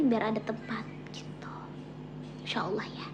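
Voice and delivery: soft unvoiced whisper. Real, not acted. Whispering a hope about her mother's visit. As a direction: breathe it out as a private wish.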